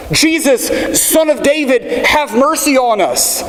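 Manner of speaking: high, slow pleading cry for mercy. Retold or imitated. Blind Bartimaeus calls to passing Jesus, a high drawn-out plea. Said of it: "And he cries out".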